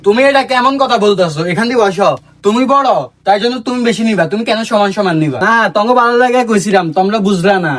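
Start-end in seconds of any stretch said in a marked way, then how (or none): none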